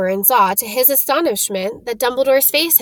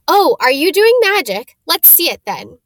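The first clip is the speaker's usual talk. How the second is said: bossy; 6 semitones higher, 5 dB louder than usual. Acted. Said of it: "She had a bossy sort of voice".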